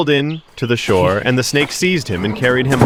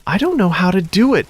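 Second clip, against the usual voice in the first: put-on innocent boy; 9 semitones higher than usual. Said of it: "Telesic said".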